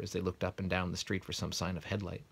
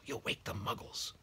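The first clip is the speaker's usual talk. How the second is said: stern hushed whisper; hissed whisper; 4 semitones higher, 4 dB quieter than usual. Acted. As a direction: scold in a whisper. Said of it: "hissed Professor McGonagall".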